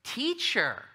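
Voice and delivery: high, fawning "Teacher"; insincere, flattering greeting; buttering up. Acted. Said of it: "total, total buttering up to Jesus".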